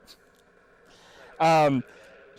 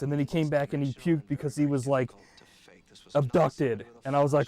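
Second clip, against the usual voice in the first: normal talking voice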